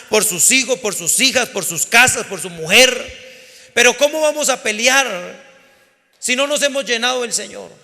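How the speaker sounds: loud, impassioned sermon delivery, rising to a rallying cry; full-force pulpit preaching, urging the flock to fight; fiery preaching. Acted. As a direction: preach it like a battle call to the congregation.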